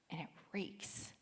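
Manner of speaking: hushed, very soft aside; near whisper. Real, not acted. Preacher describes the lifeless city dump, whispering. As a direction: drop to a whisper for effect. Grim punchline of dump image.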